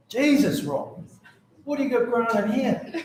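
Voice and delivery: high, loud laughing exclamation of shock; amused disbelief, voice jumping high; shocked surprise. Retold or imitated. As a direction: react like you've just caught your mate out. Opening garage, seeing the plants.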